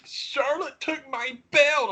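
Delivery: high, slow crybaby whine; mocking Rhea. Fan mocking a wrestler's complaints, slow high whine. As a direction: whine it like a sore loser. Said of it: "She has done nothing but".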